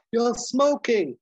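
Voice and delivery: slow, high, loud mock-sultry compliment; drawn-out teasing come-on; flirty line. Hannah flirting with Hawk, slow mock-sultry. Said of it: "Daryl Hannah says to him".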